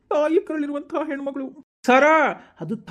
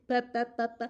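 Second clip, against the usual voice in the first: imitating a beggar's whine, slow; lightly voiced beggar, slow "ಅಮ್ಮದೇ"; mock beggar plea; 2 semitones lower, much slower than usual. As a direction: plead like a beggar asking for alms.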